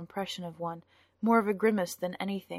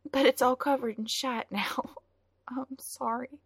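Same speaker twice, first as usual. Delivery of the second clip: high, shaky apology with a nervous laugh; tearful, nervous girl; 6 semitones higher than usual. A narrator's reading. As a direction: laugh through the fear, then apologize. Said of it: "She drew a quivering breath".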